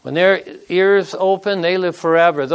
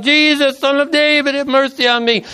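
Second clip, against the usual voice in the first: crying out; 7 semitones higher than usual. A narrator's reading. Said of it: "He cried out".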